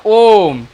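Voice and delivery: ओम with a long, held O; slow, sustained ओम; prolonged ओम. Shown as a demonstration. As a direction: stretch ओम well out before closing it. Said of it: "ओम बोल रहे हैं तो sound ज़्यादा खींच रहा है".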